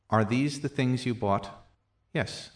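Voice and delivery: ordinary delivery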